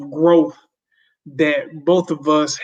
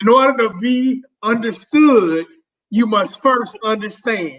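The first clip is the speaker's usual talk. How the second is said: higher-pitched, projected teacher voice; 5 semitones higher than usual. Shown as a demonstration. Repeating it for the cheap seats in a teacher voice. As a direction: call it out like a teacher herding kids. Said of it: "my teacher voice when I'm outside yelling".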